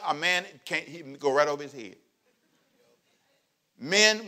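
ordinary talk, nothing marked

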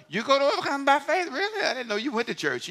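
high, mock-astonished coworker; coworker's surprise. Pastor imitating a coworker, mock surprised and high. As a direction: gasp it like a shocked colleague.

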